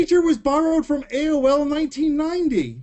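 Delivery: high, insistent protest; worked-up, high-pitched rebuttal; exasperated objection. Real, not acted. Argues against Streak's defence.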